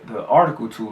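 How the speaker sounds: ordinary talk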